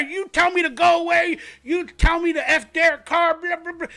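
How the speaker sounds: hammed-up furious woman yelling; angry raving fan. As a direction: rant at him like a drunk fan. Retelling drunk Raider fan yelling.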